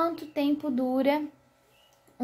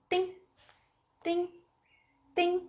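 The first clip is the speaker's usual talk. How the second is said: quick, separate pings on "tim"; brief high "tim", stopped at once; short "tim" sounds; 6 semitones higher, 4 dB quieter, slower than usual. Shown as a demonstration. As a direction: cut each ting short and distinct. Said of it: "se é um som curto".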